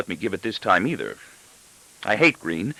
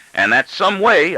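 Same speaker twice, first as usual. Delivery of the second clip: much higher and louder, slow exclamation; father throwing the words back at his daughter; exclaiming father; 11 semitones higher, 6 dB louder, slower than usual. A narrator's reading. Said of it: "I exclaim".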